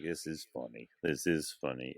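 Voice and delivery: low, hushed aside to self; inner comedian. Retold or imitated. Swimmer's inner comedian talking to himself mid-panic, quiet aside. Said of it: "the comedian in me was like".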